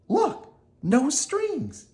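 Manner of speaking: put-on high, slow puppet-boy voice calling out; a wooden boy showing off, high and slow; Pinocchio calling out. Pinocchio showing the crowd he needs no strings, high voice. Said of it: "he called".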